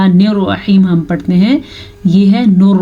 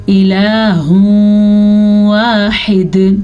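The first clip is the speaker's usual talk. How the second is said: slow, melodic tajweed recitation; Quran recitation; much slower than usual. Shown as a demonstration. Teacher reciting a verse for her pupils, slow and chanted.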